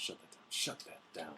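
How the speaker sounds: soft, breathless shut that down; hushed order. Real, not acted. Host quietly stopping a spoiler, hushed urgent. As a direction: hiss it out under your breath.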